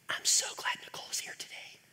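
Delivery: unvoiced whisper, a teasing aside. Real, not acted. Pastor teases about his wife to the congregation, whispered. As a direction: whisper it to the room like a secret.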